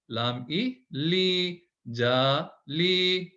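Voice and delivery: very slow letter by letter; stretched teaching syllables; slow spelled-out reading. Shown as a demonstration. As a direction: sound out each letter slowly. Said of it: "तो आपको पढ़ना है जा".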